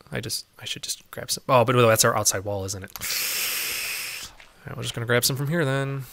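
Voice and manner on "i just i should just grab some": unvoiced whisper to herself. Real, not acted. Muttering to herself while digging, whispered. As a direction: breathe it to yourself.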